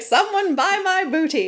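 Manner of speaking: high-pitched mock vendor's cry; playful, high-voiced calling out; mock yelling. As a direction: hawk it to a crowd, hammed up. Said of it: "yelling out".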